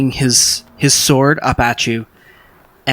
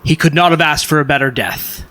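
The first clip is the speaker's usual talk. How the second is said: raised, declaiming champion's herald; proclaiming honor; 4 semitones higher than usual. Acted. Jupiter honors a fallen captain's duel, grand proclamation. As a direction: proclaim it grandly to the assembled knights. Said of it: "Jupiter Jones says".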